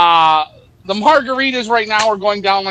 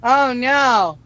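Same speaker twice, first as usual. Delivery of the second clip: whiny excuse voice; 4 semitones higher, slower than usual. Retold or imitated. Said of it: "I don't wanna hear the excuses".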